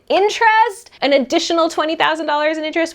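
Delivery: thrilled, high-pitched money excitement; excited. Real, not acted. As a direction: gush about the savings in a high, loud voice. Excited about savings.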